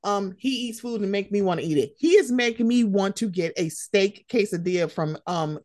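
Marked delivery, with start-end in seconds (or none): none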